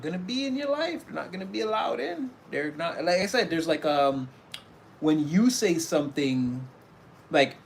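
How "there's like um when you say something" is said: ordinary talk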